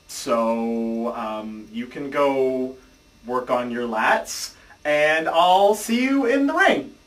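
slow dismissive. Acted. Dismissive ending of staged skit.

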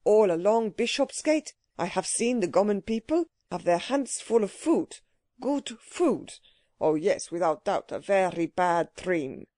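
put-on old man's thick foreign accent, hardened consonants; accented old businessman. Old Mister Levinstein tells his clerk of a bad dream, heavily accented. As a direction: play a dismayed old stockbroker with a foreign accent.

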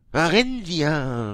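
slow, loud, high farewell call; drawn-out loud call from the gate. A narrator's reading. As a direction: call the child's name across the courtyard. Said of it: "riefen sie".

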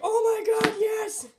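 high, loud retold shriek of joy; delighted squeal. Girl retelling getting her doll, shrieking with joy. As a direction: relive the moment you tore open the gift. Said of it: "I was like".

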